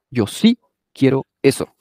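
ordinary delivery

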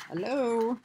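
ordinary delivery

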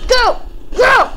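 high, loud bursts; urgent shout. Real, not acted. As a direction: shout to urge it on. Urging on in the game.

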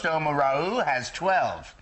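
normal talking voice

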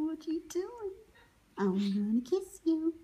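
ordinary talk, nothing marked

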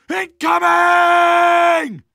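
a long bellowed alarm across the field; drawn-out warning shout. Acted. Rebel warns the defenders of incoming dropships with a long shout.

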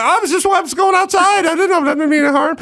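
high-pitched, defensive protest. Retold or imitated. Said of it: "then I got defensive".

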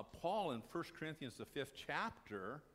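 usual speaking voice

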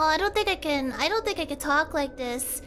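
ordinary talk

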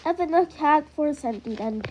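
normal talking voice

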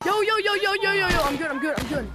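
high, loud rapid yo's; excited player yelling at the screen; excited calling. Real, not acted. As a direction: hype yourself up.

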